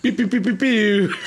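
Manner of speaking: high playful laser sounds; mock pew pew. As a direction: fire off little laser-gun noises. Imitated gun noise.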